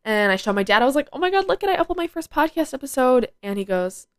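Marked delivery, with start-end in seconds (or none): 1.1-3.3 much higher, gushing replay of her own excitement; bubbling over as she reenacts the moment; retold excitement. Showing dad her first upload, excited high gush.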